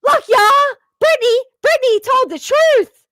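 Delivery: hammed-up giddy yelling about the truth; a mocking impression of a giddy fan jumping up; mock excited shout. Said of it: "got so excited ... was like".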